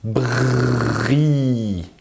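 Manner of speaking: "Bri" with a long rolling r, then long i; drawn-out r. Shown as a demonstration. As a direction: stretch the r inside "Bri". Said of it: "qu'est-ce qu'on entend?".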